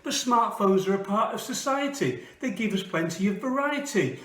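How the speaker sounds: rhythmic poetry reading; poem recitation. Acted. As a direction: perform it like spoken word. Performs a comic poem.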